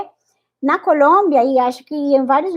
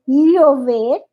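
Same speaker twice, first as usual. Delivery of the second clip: "llover" with a palatal lh sound, Panamanian or Bolivian; Panamanian accent; much slower than usual. Shown as a demonstration. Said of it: "O panameño e boliviano é".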